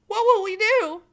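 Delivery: high, loud wail; loud, high despairing wail. Acted. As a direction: cry it out in despair. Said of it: "wailed Cook".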